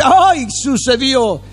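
drawn-out, high astonished gasp; mock surprise. Preacher mimicking believer amazed at a miracle, drawn-out and high. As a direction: act stunned, slow and high.

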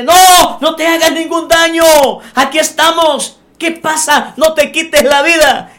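high, loud pleading cry to stop him; urgent exclamation. Acted. Paul crying out to the suicidal jailer, high loud plea. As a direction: shout out in alarm to save a life. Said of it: "Pablo exclama y dice".

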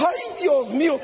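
high yelp of fright, acted without real volume; mock frightened cry. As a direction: imitate a preacher panicking at the devil. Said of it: "pega un grito".

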